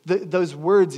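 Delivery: normal talking voice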